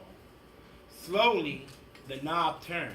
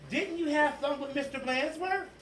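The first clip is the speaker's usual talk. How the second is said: teasing teacher; 8 semitones higher than usual. Acted.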